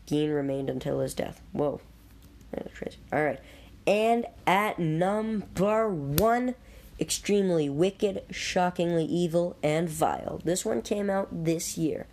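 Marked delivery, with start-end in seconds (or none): 3.9-10.4 countdown reveal. Acted. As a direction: draw out the big reveal. Countdown announcement.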